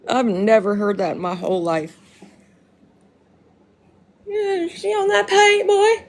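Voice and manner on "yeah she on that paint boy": slow, high-pitched put-on holler; playful calling out. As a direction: stretch it out high, as a joke. Repeats the imagined yell for fun.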